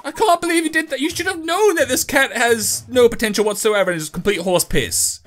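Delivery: high mocking viewer voice; mocking critics. Mocking viewers scolding Chauncey, in a high sneering voice. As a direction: sneer like a know-it-all commenter. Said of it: "people are gonna be like".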